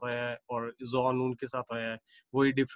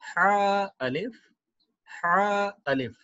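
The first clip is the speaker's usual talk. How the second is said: slow reading; 5 semitones higher, much slower than usual. Shown as a demonstration. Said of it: "next पढ़ते हैं हा अलिफ".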